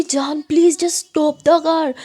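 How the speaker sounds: urgent plea. A narrator's reading. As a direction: beg the speeding driver to stop. Pleading with girlfriend driving too fast. (घबराकर ज़ोर से गुज़ारिश)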